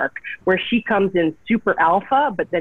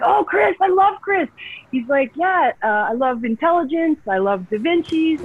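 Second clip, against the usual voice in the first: high-pitched, enthusiastic quote of a fan; delighted gushing; 9 semitones higher than usual. Retold or imitated. Showrunner gushing to actress about an old colleague, imitated delight. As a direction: gush it, imitating someone's delight.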